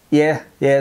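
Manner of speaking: normal talking voice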